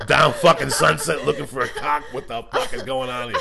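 loud giggling riff; laughing rant. Real, not acted. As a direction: rant while cracking up. Laughing rant about apocalypse.

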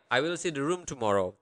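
fast, joined-up recap; quick run-through. Shown as a demonstration. Teacher recapping the translated sentence, quick run-through.

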